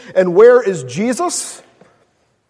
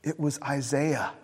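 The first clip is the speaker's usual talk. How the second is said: much softer, lower, with a small laugh; a gentle, unbothered answer; calm reply; 6 semitones lower, 12 dB quieter than usual. Retold or imitated. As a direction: say it simply, the matter is settled.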